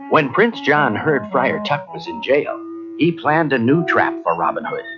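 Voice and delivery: ordinary delivery, nothing marked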